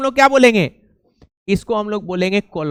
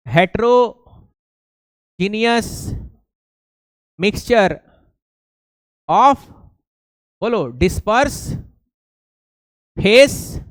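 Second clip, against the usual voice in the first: slow dictation; much slower than usual. Shown as a demonstration. Dictating the definition slowly.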